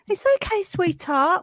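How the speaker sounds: high, sweet mock comfort; lightly imitating a friend's soothing tone; friend consoling. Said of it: "my friend just went like".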